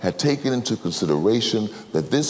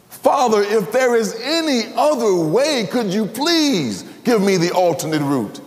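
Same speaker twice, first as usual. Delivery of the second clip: pleading prayer; 13 semitones higher than usual. Acted. Jesus praying to the Father in Gethsemane, high desperate plea. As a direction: beg the Father for another way.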